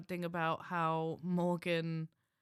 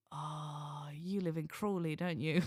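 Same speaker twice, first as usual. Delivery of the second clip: mock sympathy; 4 semitones lower than usual. Retold teasing remark to a friend.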